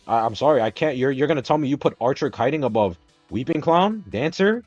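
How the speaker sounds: ordinary delivery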